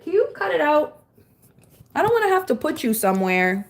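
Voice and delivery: high, sing-song scold at a pet; scolding the dog. Real, not acted. Owner scolding her misbehaving dog, high pet voice. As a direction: tell the puppy off in a pet voice.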